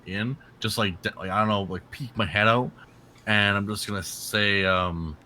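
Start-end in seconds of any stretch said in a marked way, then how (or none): none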